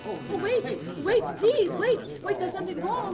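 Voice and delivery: high, loud frantic pleas; panicked pleading. Acted. Exposed medium begs her angry sitters to wait, panicked. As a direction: beg them to stop before it all falls apart.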